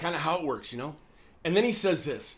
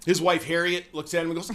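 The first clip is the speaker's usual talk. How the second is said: usual speaking voice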